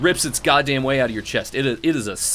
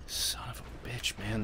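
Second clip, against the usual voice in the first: whispered curse; 15 dB quieter than usual. Real, not acted. Dying again, muttered.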